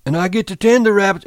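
put-on high, fast excited childlike voice; rushing with delight; Lenny's eager voice. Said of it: "Lenny voice".

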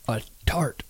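whispered gossip. A narrator's reading. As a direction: drop to a sly whisper. Swamper's sly confidence.

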